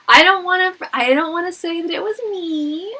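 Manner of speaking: high, loud words still full of laughter; laughing gleefully. Real, not acted. Telling viewers he was fired, laughing and gleeful. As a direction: gloat through the giggles. Gleeful about his firing.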